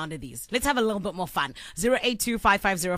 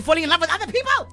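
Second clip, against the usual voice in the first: higher, faster outburst of disbelief; indignant; 7 semitones higher, 4 dB louder, faster than usual. Real, not acted. Contestant complaining about her date seeing others, indignant. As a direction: rush it out, pitch raised in protest.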